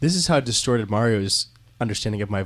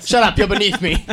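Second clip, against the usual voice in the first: snobby snap; 8 semitones higher, 5 dB louder than usual. Retold or imitated. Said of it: "He'd be like".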